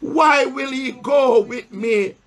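solemn pulpit Bible reading; scripture reading. Acted. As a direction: read the verse slowly to the church, pausing for answers. Preacher reads scripture aloud.

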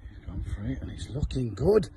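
hushed golf commentary voice; soft, quiet TV golf announcer; golf commentator. Pretend.

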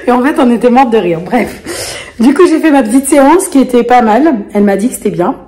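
normal talking voice

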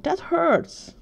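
high-pitched protest of hurt; pained complaint. A narrator's reading. Tucker to Sam after her kick, pained complaint. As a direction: whine it high, falling at the end.

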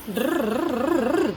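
long wavering rolled drrr; trilled engine going up and down; car engine noise. Pretend. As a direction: rev a pretend toy motor. Imitating a toy car engine.

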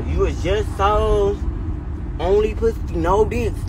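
high, drawn-out mocking tone, slow; a sneering mock of their flip-flop. Mocking the other girls' change of heart, in a high sneer.

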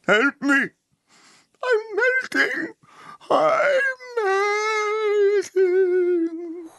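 high, crying witch wail fading to a whisper; wicked witch melting. Retold or imitated. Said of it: "the wicked witch of the west".

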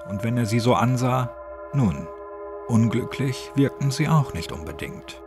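plain reading voice

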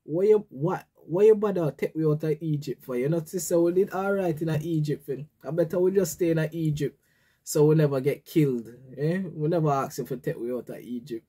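exasperated Jamaican patois rant. Retold or imitated. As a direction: complain like an ungrateful crowd in Jamaican patois. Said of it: "I'm gonna say in Jamaican".

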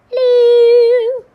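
high, loud cry of hello; loud greeting. Acted. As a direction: belt the hello across the room. Greeting the first nominee.

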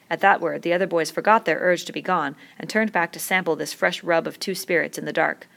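usual speaking voice

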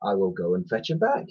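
soft, low purr; hushed, sly purr. A narrator's reading. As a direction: purr it silkily and softly. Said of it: "purred Edmund".

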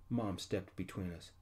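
ordinary delivery, nothing marked

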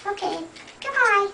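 squeaky goodbye. Acted. Reading to a child, a squeaky playful goodbye.

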